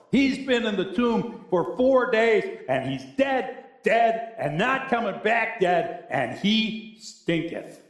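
slow, stressed "dead, dead"; drawn out "and he stinketh"; emphatic dead dead. Acted. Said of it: "preaching emphasis".

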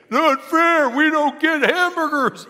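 a preacher mocking his congregation's greedy whining; whiny complaint. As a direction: whine it like a sulking kid. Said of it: "we'd be saying".

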